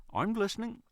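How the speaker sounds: mumbled through food; mouth full. A narrator's reading. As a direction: talk round a mouthful. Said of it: "she mumbled with a mouthful".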